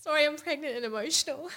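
half-laughing through real tears; choked up, laughing at herself; teary and emotional. Real, not acted. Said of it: "getting, like, emotional now thinking about it".

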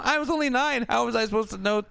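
put-on very high, pleading girl voice; high whiny defence. Brenda defending herself over the dog, high and whiny. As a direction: whine it high in self-defence. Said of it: "she was like".

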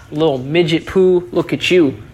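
ordinary talk, nothing marked

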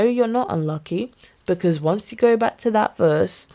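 usual speaking voice

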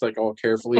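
normal talking voice

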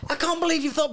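high-pitched, amazed protest; astonished disbelief. Real, not acted. Host amazed co-hosts made the same pick, incredulous.

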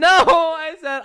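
high, loud, drawn-out objection; protesting. Real, not acted. Storyteller objecting to co-host's version, loud protest. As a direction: stretch the denial out in loud protest.

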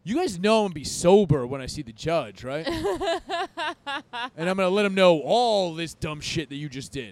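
loud, drawn-out warning, retold; acted loud threat. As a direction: replay threatening the cops with payback. Retelling his threat to the cops.

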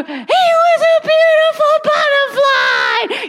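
very high, slow, drawn-out triumphant reading; grand storybook reveal. Retold or imitated. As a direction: sing it out like the big finale to a baby. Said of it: "I turn the page and go".

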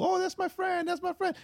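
very high-pitched imitation of a schoolkid; kid voice. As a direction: squeak it like a little kid claiming a pal. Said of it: "when you're a kid and you're in school".

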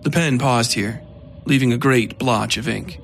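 ordinary delivery

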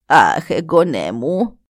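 αργό, χαμηλότερο «Αχ» γιαγιάς; μάλωσέ τον τρυφερά σαν γιαγιά; αργός αναστεναγμός γριάς. Acted. Η γριά απαντά στις σκέψεις. (η γριά απαντά στις σκέψεις του εγγονού, αργή χαμηλή φωνή)

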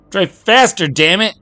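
very high, loud screech in labour; a pregnant wife yelling at the driver. Acted. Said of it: "Patty screeched at him".

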